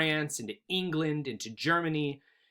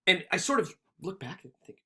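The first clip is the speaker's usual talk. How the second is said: ordinary talk, nothing marked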